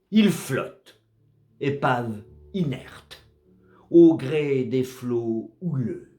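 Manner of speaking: slow, solemn verse reading with measured pauses; poem recitation. Acted. Narrator recites a sea poem about a drifting wreck, measured recitation. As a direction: recite it as poetry, line by line, falling at the ends.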